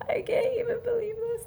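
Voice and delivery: soft, airy whisper of disbelief; whispered mock-tearful shock. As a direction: act stunned, barely voicing it. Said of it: "I was just shocked".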